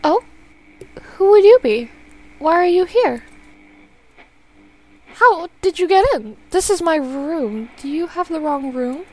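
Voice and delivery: confused and wary of a stranger; frightened mother, voice lifting; alarmed old woman. Acted. Mother fails to recognize son.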